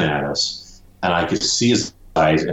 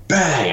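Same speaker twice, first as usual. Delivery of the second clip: imitated bang; 9 semitones higher than usual. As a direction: hit "bang" again high, like another blow. Imitating hitting the gator.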